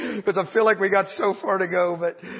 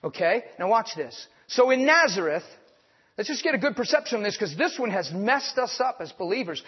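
ordinary talk

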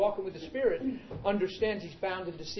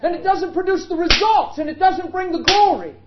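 high, loud preacher's climax; full-throated sermon peak; preaching at full force; 13 semitones higher, 15 dB louder than usual. Acted. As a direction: hammer the point home to the congregation.

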